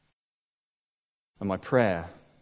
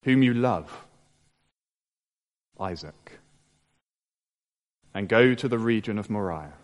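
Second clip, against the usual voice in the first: scripture reading; 2 semitones lower than usual. Acted. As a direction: read the passage gravely, letting each phrase land. Preacher reads the Genesis passage.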